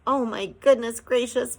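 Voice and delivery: wailed loud and high; Homily's dismayed wail; moaning. A narrator's reading. Homily moaning at the news of leaving. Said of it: "moaned Homily".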